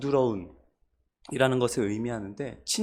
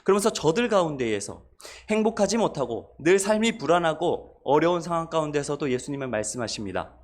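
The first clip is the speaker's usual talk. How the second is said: normal talking voice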